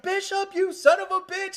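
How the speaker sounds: high, loud shout at Bishop; yelled movie line. Retold or imitated. Ripley's line retold to a friend, high mock yell. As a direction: belt the accusation out, high and loud. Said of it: "she's like".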